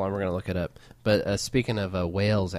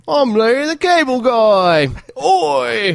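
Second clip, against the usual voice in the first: very high, loud, drawn-out mock English; over-the-top English accent, shouted and stretched; thick English accent; 13 semitones higher, 10 dB louder, slower than usual. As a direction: belt out a hammed-up English accent. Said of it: "fucking thick English accent".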